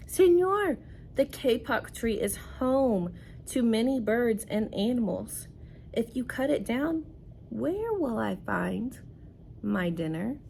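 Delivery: lightly voiced jaguar; reading to children. Teacher reads jaguar's speech.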